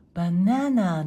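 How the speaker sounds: バナナ with a strong stress on the middle ナ, English-style; English "banana" stress pattern on バナナ; English-style accent. Shown as a demonstration. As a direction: punch and stretch the second syllable of バナナ. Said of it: "アクセントがはっきりして".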